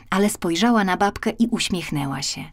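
ordinary delivery, nothing marked

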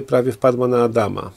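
ordinary delivery, nothing marked

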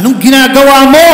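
a sharp call-out to idle workers; loud demanding question. Acted. God confronting idle workers: loud, quick challenge. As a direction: ask it loud, fast and pointed.

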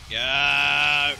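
loud, sudden shout. Real, not acted. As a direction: yell it as a rallying cry. Yelling at teammates to run.

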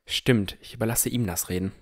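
usual speaking voice

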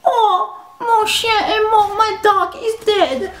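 high, slow fake-sob whine; mocking crying classmate. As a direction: mimic a whiny girl wailing about her dog. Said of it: "she'd be all like".

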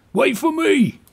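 eager call. Acted. Winston calling after his friend, eager. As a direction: call out while hurrying after. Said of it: "he says as he scurries off".

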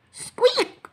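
very high little mouse squeak; a small, high squeaking sound; tiny squeak. Acted. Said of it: "squeak went a voice, a very tiny voice".

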